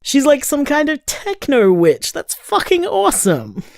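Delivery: high-pitched, gushing excitement; thrilled admiration. Acted. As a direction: rave about a friend, pitch soaring. Gushing over friend's techno magic.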